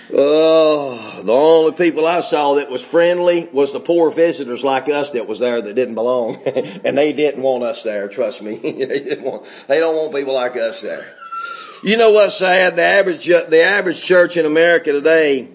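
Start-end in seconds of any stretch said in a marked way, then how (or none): none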